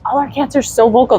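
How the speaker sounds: normal talking voice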